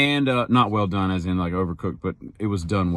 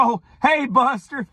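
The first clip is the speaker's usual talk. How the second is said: cheery greeting; 14 semitones higher than usual. Retold or imitated. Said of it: "she just turns around and she goes".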